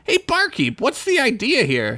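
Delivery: high character voice. Acted. Bilbert calls the barkeep.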